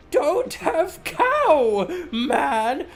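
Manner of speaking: slow stretched goofy voice; slow cartoon voice. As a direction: drag it out like a cartoon. Repeated catchphrase.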